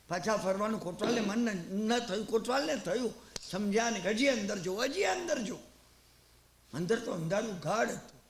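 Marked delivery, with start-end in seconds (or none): 4.8-5.6 હજી raised high, urging onward; higher urging inner voice. Retold or imitated. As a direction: push the inner voice up, insisting. Inner voice urges kotwal onward. (ઊંચા સ્વરે આગ્રહ)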